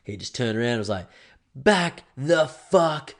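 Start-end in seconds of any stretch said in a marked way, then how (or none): 1.5-3.1 very slow, loud, higher snarl of back the fuck off; an angry movie-star dad losing it; a Hollywood nice guy finally snapping; Tom Hanks. Retold or imitated. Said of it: "in his, uh, Tom Hanks way".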